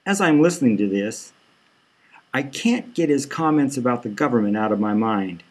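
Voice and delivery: ordinary delivery, nothing marked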